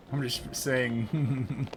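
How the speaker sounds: ordinary talk, nothing marked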